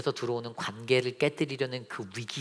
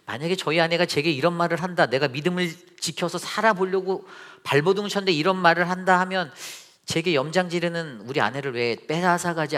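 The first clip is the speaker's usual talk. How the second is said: louder, higher pulpit delivery; raised, insistent preacher's voice; impassioned preaching; 5 semitones higher, 6 dB louder than usual. Real, not acted. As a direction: press the hypothetical with force.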